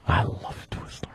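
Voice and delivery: slow, voiceless whisper. Pretend. Confessing a love of candy to the room, slow whisper. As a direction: whisper it slowly, savoring each word.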